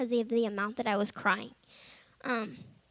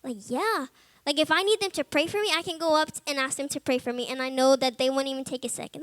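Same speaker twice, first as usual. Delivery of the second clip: ordinary talk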